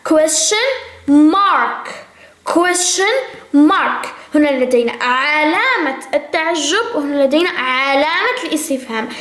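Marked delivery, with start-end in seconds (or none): 0.1-4.1 slow drilled model. Shown as a demonstration. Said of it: "teacher drilling English terms".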